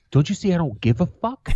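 retold irritated retort; snappy comeback. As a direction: throw the question back with attitude.

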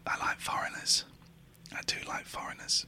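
hushed whisper. Real, not acted. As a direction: whisper it near the phone. Whispering while call rings.

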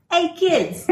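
high-pitched, badly faked British accent; hammed-up British call, breaking into laughter; horrible British accent. Shown as a demonstration. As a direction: greet them in a terrible British accent. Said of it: "in my horrible British accent".

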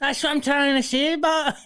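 very high, loud put-on voice; exasperated high-pitched mock protest; squawking comic voice. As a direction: keep up the hammed-up squawk. Carries on in the squawking voice.

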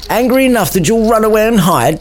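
high-pitched mocking taunt. Gregory needling Samson about running away, mocking high taunt. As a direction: tease them in a high put-on voice. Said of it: "taunted Gregory".

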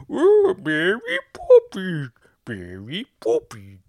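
exaggerated English-accent wail, swooping high and drawn out; hammed-up, slow, mangled English accent; bad English accent. Comic mimicking what English people say, bad English accent. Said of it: "That's me doing a bad English accent".

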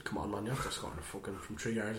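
low, quiet grumble; soft, low retold complaint; muttered exasperation. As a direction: mutter it under your breath. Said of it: "And I was a bit like".

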